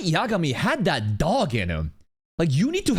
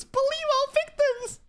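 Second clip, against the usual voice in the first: mocking quote; slower than usual. Mock quote of Pokemon.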